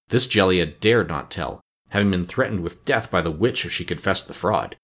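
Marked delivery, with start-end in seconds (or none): none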